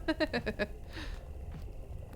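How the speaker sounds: soft, breathy chuckle; laugh. Real, not acted. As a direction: chuckle quietly at your own joke. Laughs at own joke.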